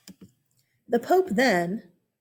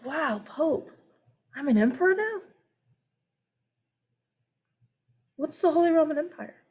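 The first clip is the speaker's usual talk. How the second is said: surprised; 4 semitones higher than usual. Retold or imitated. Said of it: "He says".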